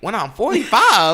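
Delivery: high, loud and slow, laughing in disbelief; retelling the moment he sees the loan age, cracking up in disbelief; incredulous mock outrage. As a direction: shout it like it just hit you. Retells realizing the payoff age.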